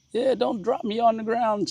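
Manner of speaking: high, lightly voiced dog. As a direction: speak as the pet dog. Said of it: "says my dog".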